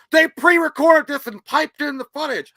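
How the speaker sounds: loud, slow dumb-guy quote; mocking voice. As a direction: mimic him, loud and slow. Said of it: "He's like".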